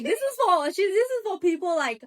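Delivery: retelling the botched pitch to the boss, mock flustered; imitating the fumbling character. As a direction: blurt it out like a nervous presenter. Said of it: "She's like".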